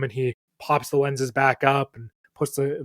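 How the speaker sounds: normal talking voice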